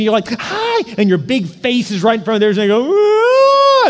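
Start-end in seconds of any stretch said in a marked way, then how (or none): 0.3-0.9 high-pitched squealed hi; overeager baby greeting. Retold or imitated. Adult right in a baby's face, high overeager greeting. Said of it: "come up to a baby". 2.9-3.9 loud, very high mock scream; imitated scared baby scream. Baby scared by a big face, loud high imitated scream. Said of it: "and scares them".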